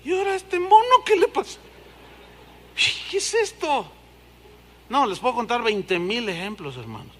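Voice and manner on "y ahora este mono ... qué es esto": high, baffled exclamation; high surprised mock reaction; startled. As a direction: react to a man rolling past. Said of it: "y veo".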